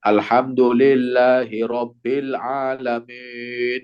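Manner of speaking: slow alhamdulillahirobbilalamin with i bent toward e; imam's slanted kasroh. Retold or imitated. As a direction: recite like an imam who won't grin. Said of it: "ketika imam itu".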